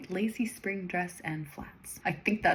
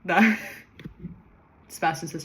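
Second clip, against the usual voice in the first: usual speaking voice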